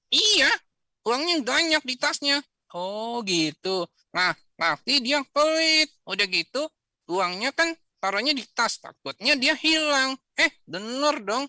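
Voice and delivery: put-on high, eager little boy telling about his friend; child puppet voice. Puppet Rio telling Kak Izul about Udin, in a high eager voice. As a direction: chatter like an excited kid.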